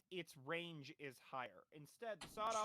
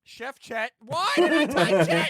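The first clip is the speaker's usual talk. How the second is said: loud, high, laughing disbelief; 5 semitones higher, 23 dB louder, slower than usual. Real, not acted. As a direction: yell it at your own mistake.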